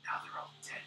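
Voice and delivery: put-on faint, breathy whisper of a dying old man; feeble old man. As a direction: whisper it like a frail, wounded elder. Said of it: "in his feeble breath".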